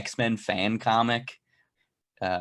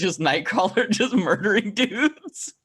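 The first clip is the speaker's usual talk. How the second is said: high, loud, laughing through the words; real laughter pushing the voice up; amused laughing; 9 semitones higher, 6 dB louder than usual. Real, not acted. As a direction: crack up while telling it. Laughing at own gory childhood comic.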